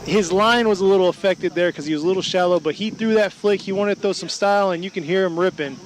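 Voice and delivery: energetic live drift call; play-by-play commentary. Acted. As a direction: call the run as it happens.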